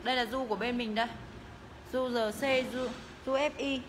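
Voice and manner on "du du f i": normal talking voice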